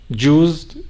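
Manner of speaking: ordinary talk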